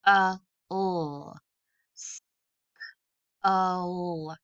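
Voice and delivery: l, S-, oh stretched as separate sounds; sounds tapped out. Shown as a demonstration. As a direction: tap each sound of skull apart. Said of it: "Let's tap it out".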